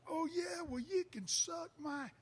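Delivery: high, loud sneering taunt, slow; mock taunt. Heckler taunting the teller before the punch, high loud sneer. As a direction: taunt back like a cocky drunk.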